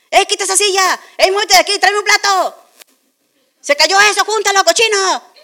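high, loud yelled orders across the house; a hammed-up retold shout, rough and bossy; family yelling. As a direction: bark orders like the whole family used to. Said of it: "No podías hablar si no gritabas".

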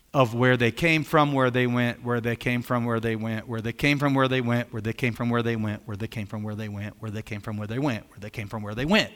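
mock-bored chant fading away; monotonous droning list. Shown as a demonstration. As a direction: drone on like a dull list reading. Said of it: "You want me to keep saying that?".

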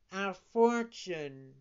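slow, drawn-out cat echo. Acted. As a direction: purr it back slowly. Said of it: "repeated the cat".

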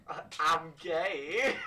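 loud, high, drawn-out words through laughter; real laughing. Real, not acted. Player blurts a joke answer to the wizard, laughing. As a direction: crack up while blurting it out slowly.

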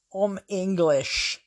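slow, drawn-out Cockney; thick London Cockney accent. Retold or imitated. Woman answering a bad French speaker, in thick London Cockney. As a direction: put on a thick Cockney, stretching the words. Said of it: "in the thickest London Cockney accent".

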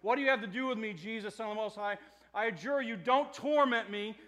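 loud, pleading cry from the possessed man; demon crying out. Acted. Possessed man crying out to Jesus, loud and pleading. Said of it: "he cries out with a loud voice".

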